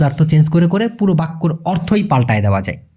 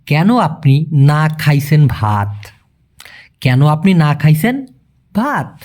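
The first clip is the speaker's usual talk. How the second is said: slow, word-by-word Malay order; slowly, word by word; slower than usual. Shown as a demonstration. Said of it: "ওদের ফরম্যাটটা এরকম হয়ে যায়".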